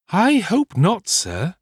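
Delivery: high, drawn-out housekeeper voice; old housekeeper. A narrator's reading. Housekeeper replies to her master about his cold, high and slow. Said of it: "said Missus Bedwin".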